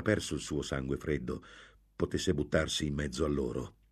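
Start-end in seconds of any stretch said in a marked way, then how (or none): none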